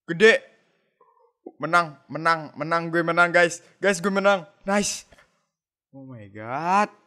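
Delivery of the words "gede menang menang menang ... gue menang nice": loud, high victory yells; shrieking jackpot winner. Real, not acted. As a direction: shout out the big win. Winning a big hand. (teriakan menang jackpot)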